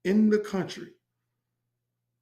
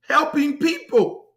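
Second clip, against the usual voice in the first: high, slow stretched "helping people"; high drawn-out catchphrase; 8 semitones higher, 5 dB louder than usual. Pretend. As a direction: sing out the tagline, drawn out.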